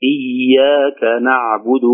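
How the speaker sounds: slow إياك نعبد, double y lengthened gently; soft held doubled ya. Shown as a demonstration. Teacher demonstrating again, slow relaxed shadda on إياك. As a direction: stretch the y of إياك without pressing. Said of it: "فيها رخاوة".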